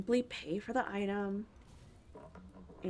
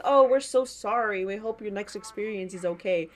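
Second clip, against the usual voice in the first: mock corporate apology; 9 dB louder than usual. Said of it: "AI generates a response and says".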